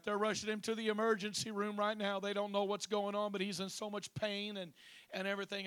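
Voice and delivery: wife calling the pastor in panic, frantic. Retold or imitated. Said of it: "she's frantic".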